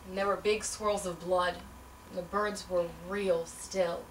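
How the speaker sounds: quiet numb grief over the dead birds; hushed. Acted. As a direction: let it fall away.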